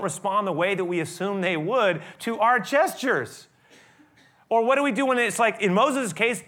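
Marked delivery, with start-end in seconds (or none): none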